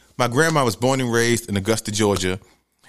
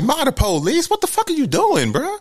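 high, disbelieving objection to his mother; incredulous protest; 13 semitones higher than usual. Retold or imitated. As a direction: squeak out the disbelief. Arguing with his mom.

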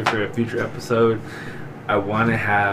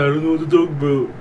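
mimicking the girls; 4 semitones higher, faster than usual. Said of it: "all the girls always say".

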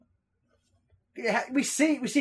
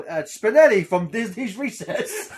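retold blurt; 6 semitones higher, 6 dB louder than usual. Retells both blurting the name.

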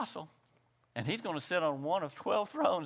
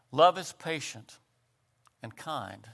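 phrase-by-phrase scripture reading; slow, deliberate reading; 3 semitones lower than usual. Shown as a demonstration. Preacher reading Corinthians so each phrase sinks in, slow reading. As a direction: read it out with a pause after each phrase. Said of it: "I'm gonna read it slowly".

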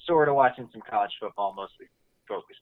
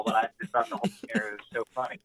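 usual speaking voice

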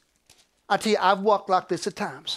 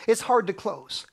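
ordinary talk

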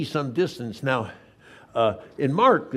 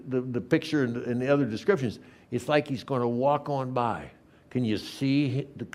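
ordinary delivery

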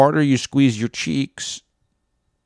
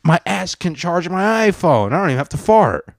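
much higher, pleased showing-off voice; smug pretend voice; 8 semitones higher than usual. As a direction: brag like you invented it. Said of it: "I'll be like".

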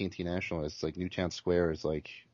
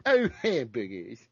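dopey slow voice; 5 dB louder, slower than usual. Acted. Dopey Cartman-like quip.

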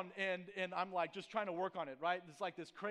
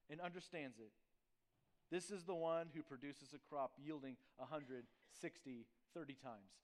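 scripture reading; 4 dB quieter than usual. Acted. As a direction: read the verses with quiet weight. Reads scripture aloud.

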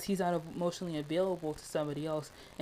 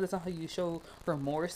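ordinary delivery

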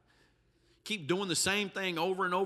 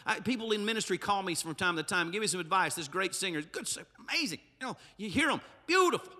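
ordinary talk, nothing marked

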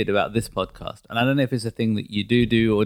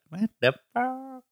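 imitating wife; 13 semitones higher, 4 dB quieter, much slower than usual. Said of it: "your other half would probably be like".

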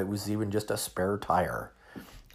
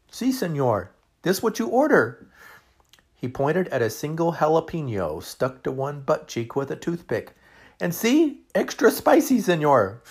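put-on high, loud, heavy Mexican accent; waiter's Spanish accent; 7 semitones higher, 6 dB louder than usual. Waiter Ramon proudly presents the dish, high Mexican accent. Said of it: "He smiled".

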